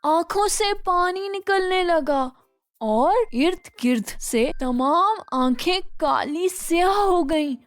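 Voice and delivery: ordinary delivery, nothing marked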